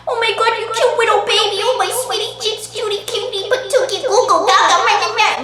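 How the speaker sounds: put-on very high, rapid cooing baby talk. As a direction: gush in a squeaky, exaggerated baby voice. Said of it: "I don't think I ever used a baby voice".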